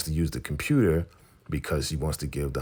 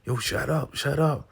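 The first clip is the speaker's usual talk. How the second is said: urgent hushing; 11 semitones higher than usual. Retold or imitated. Urgent hushing in retold scene.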